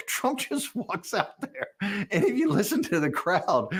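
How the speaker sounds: talking through laughter, pitched high; real amused laughter. Real, not acted. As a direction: tell it while cracking up. Laughing as he tells the story.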